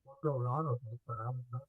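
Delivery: muffled, hollow barrel sound; like talking from inside a barrel. Real, not acted. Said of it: "Now you sound like you're in a barrel".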